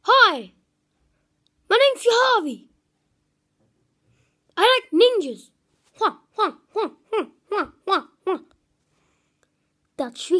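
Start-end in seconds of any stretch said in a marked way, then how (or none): 0.0-5.5 high, loud put-on voice; squeaky hyper mate impression; imitating Sri Harvey, pitched up and loud. 6.0-8.4 high laughing ninja hua cries; hammed-up ninja noise through laughter; playful pitched-up ninja chant; mock ninja cries.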